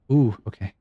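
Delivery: ordinary talk, nothing marked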